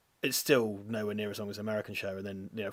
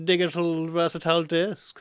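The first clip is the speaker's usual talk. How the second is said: higher, louder goofy announcement; silly put-on voice; 5 semitones higher, 5 dB louder than usual. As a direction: intone it daftly for no reason. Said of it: "no idea why I did".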